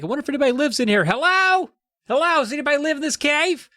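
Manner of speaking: high, fast, sing-song calls into a cave; bright mock call into an echoing cave; Terry calling into cave. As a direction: play the eager kid hollering hello into the dark.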